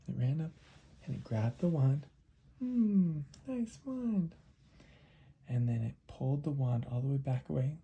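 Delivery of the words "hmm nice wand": very high, tiny creature voice; high-pitched mouse, pleased; little mouse voice. Acted. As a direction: squeak it out as an admiring mouse. Mouse admires the wand.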